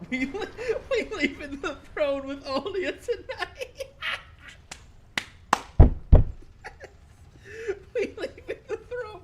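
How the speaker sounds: high, loud laughter over the words; laughing hard. Real, not acted. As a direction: crack up while saying it. Laughing reading chat joke.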